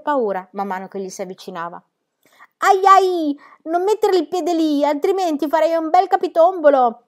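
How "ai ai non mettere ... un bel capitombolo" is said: high, loud cry of warning; alarmed warning. Acted. Pippi warning climbing Book about his footing, loud high alarm. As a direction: call out to stop a misstep.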